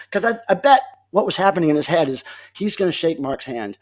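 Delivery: normal talking voice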